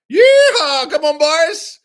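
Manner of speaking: very high, loud yeehaw; cowboy whoop. Acted. Pilots setting off.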